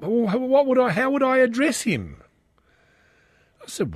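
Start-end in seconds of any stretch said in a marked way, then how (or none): 0.0-2.2 flustered wife. Retold or imitated. Dad voices fretting wife.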